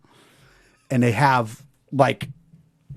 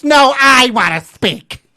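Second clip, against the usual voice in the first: higher, louder indignant woman, slow; imitating shop woman; 8 semitones higher, 7 dB louder, slower than usual. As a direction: cut in as the offended woman, loud and high. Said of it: "The woman from Dunkin' Donuts".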